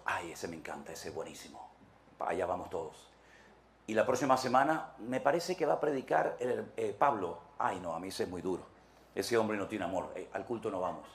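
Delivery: low, soft, sniffy church gossip; hushed picky congregant; gossiping churchgoer. Retold or imitated. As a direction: dismiss the preacher with a pout.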